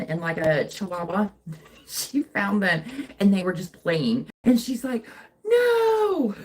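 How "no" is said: high, drawn-out mock wail; loud, high-pitched toddler protest, hammed-up; imitating upset child. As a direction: wail it like a distressed little kid. Imitates child upset at dogs fighting.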